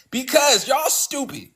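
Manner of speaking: high, drawn-out exasperated yell; frustrated yell. Acted. Host answers the critic who questions the irritation, exasperated yell. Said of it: "if I get frustrated and I yell".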